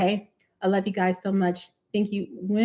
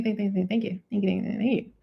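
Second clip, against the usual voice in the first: ordinary talk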